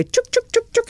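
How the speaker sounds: high, quick imitated slurp; slurping chuk sounds. Shown as a demonstration. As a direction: mimic sucking an oyster from its shell. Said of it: "you scoop from the back and you go".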